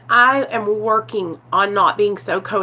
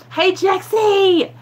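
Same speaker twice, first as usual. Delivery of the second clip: cheery greeting; 8 semitones higher, slower than usual. Real, not acted. Greeting a live chatter.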